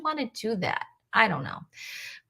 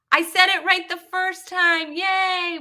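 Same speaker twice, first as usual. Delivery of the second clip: high, loud little celebration; triumphant cheer; 8 semitones higher, 4 dB louder than usual. Real, not acted. Host learns she said the name right, triumphant. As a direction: crow about getting it right.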